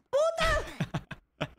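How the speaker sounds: high-pitched cursing yell; frustrated outburst. Real, not acted. Player cursing a failed jump, high frustrated yell. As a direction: yelp the curse as it goes wrong. Player fails and curses the game.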